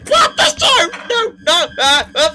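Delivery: very high, loud terrified shrieking; panicked scream. Real, not acted. Enemy appears suddenly.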